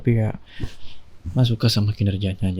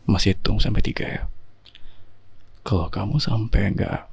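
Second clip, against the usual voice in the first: mock stern; 2 semitones lower than usual. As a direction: pretend to scold her into sleep, half joking. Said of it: "Ini Mas serius loh". (suami pura-pura galak menyuruh istri tidur, nada rendah berbisik)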